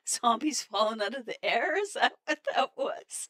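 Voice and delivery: high, loud and cracking up; startled laughter. Real, not acted. Player startled by zombies falling from the air, startled laughter. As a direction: exclaim it in disbelief through a burst of laughter.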